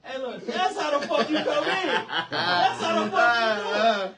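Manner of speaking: loud laughing praise; laughing hype. Real, not acted. Host cheering the guest's intro, laughing loudly. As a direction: cheer him on through laughter. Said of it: "laughing praise".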